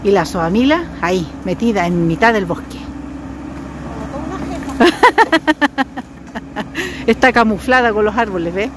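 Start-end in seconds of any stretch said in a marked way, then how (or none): 2.8-6.0 long high chuckling laugh. Real, not acted. Seeing Mila hidden in the bushes, long high laugh.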